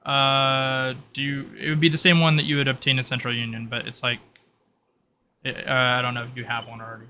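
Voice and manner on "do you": ordinary talk, nothing marked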